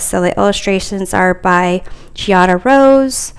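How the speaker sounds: ordinary talk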